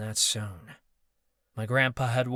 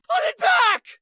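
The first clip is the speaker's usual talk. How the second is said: much higher, louder anguished shout; pained shout; 10 dB louder than usual. Acted. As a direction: shout it out in pain. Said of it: "Marcus shouted in a pained voice".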